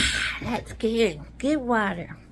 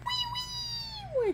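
soft, much higher squeak; high toy voice; 8 dB quieter than usual. Pretend. Toy comforting little Lee, high and soft. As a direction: coo like a tiny toy soothing a friend.

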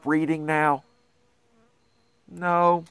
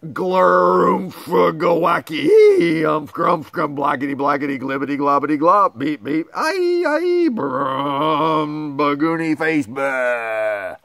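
silly nonsense sounds; 4 dB louder than usual. Acted. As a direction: ham it up like goofy nonsense. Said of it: "make silly sounds like".

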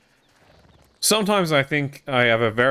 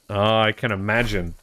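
ordinary delivery

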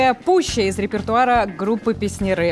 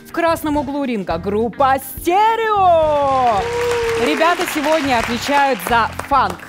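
boxing ring announcer; 3 semitones higher than usual. Acted.

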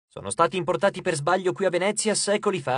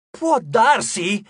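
slow, louder, higher disbelieving repeat; incredulous echo; 5 semitones higher, 5 dB louder, much slower than usual. Acted. Frank echoes the host's shrug in loud disbelief. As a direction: throw the words back in outrage.

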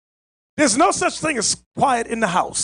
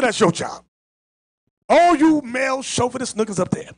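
ordinary talk, nothing marked

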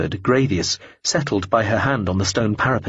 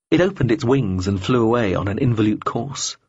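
plain reading voice

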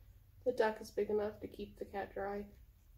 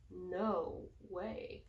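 low, softer refusal; grumpy cat; 3 semitones lower, 7 dB quieter than usual. Acted. Voicing the cat refusing duck, low sulky.

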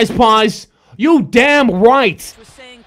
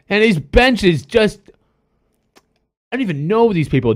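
normal talking voice

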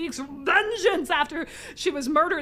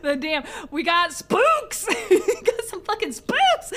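laughing through the words, higher; giddy with laughter; high laughing squeal of delight; laughing excitedly; 5 semitones higher, 5 dB louder, slower than usual. Real, not acted.

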